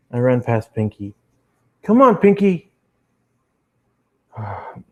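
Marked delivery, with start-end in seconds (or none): 1.8-2.7 high, loud urgent call. Acted. Fleeing police sirens, calling Pinky loudly and high. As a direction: hurry her away from the sirens.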